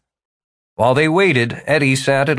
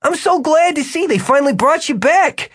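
much higher, effusive welcome back; delighted old friend. A narrator's reading. Jimmy welcomes Tyler back, delighted. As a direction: gush like meeting a recovered friend. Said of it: "took on the attitude of a man who has just bumped into an old friend".

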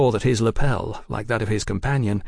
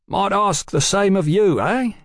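high, louder, insolent comeback; 10 semitones higher, 4 dB louder than usual. A narrator's reading. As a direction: throw it back cheekily. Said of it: "the taller man said insolently".

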